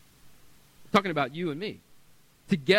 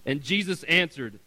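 normal talking voice

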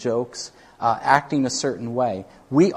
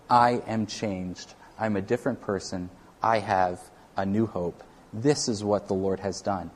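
gentle testimony; 5 semitones lower, 5 dB quieter than usual. Retold or imitated. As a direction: answer them gently, without any edge. Gentle answer with gentleness, respect.

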